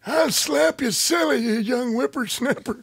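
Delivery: put-on high, loud cranky old man yelling; cackly wheezy old timer. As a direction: threaten like a grumpy grandpa. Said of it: "You sound like an old timer".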